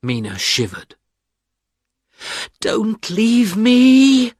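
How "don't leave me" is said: high, slow pleading voice; cling to him, slow and high; pleading cry. A narrator's reading. Wounded Mina begging her husband, slow high plea. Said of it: "she cried".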